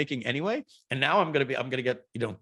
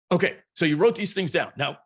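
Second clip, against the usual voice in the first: higher, punchy guru voice; a light take on a pushy self-help coach; lightly voiced seminar speaker checking in; Tony Robbins impression; 6 semitones higher, 3 dB louder than usual. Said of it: "you busted a couple out there".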